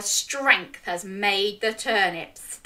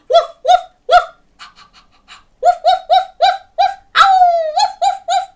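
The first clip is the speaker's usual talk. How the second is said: high, loud yapping woofs; barking like a dog; 10 dB louder than usual. Pretend. Showing children how to bark, high yapping. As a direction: yap like an excited puppy. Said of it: "can you bark like a dog".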